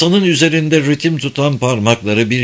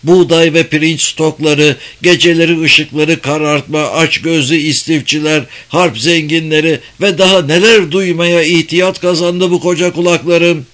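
raised, complaining rant; angry; 5 semitones higher, 3 dB louder than usual. Acted. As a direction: list grievances, rising in anger. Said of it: "Sesindeki perdeyi yükselterek".

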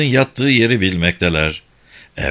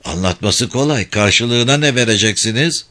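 ordinary delivery

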